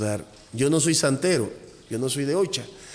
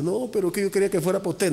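quick, higher insisting voice; imitating a pushy client, higher and fast; insistent client; 7 semitones higher, faster than usual. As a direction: press him to take it, fast and pitched up. Client insisting money is for him.

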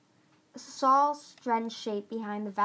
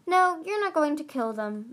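sharp, raised retort; snapped; 6 semitones higher, 4 dB louder than usual. Acted. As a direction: cut them off sharply. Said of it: "Marsier snapped".

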